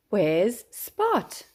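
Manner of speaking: usual speaking voice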